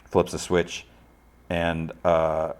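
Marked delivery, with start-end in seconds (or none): none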